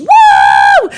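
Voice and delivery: loud, very high "woo"; celebratory whoop. Retold or imitated. Dancing at a winning table, celebratory whoop. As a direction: let out a big party whoop.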